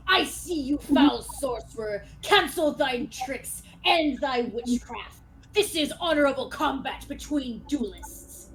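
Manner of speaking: loud, slow, outraged proclamation; righteous duelist calling out a cheat; accusing knight. Acted. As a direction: denounce the sorcerer in ringing tones. Knight rebukes a sorcerer's trick.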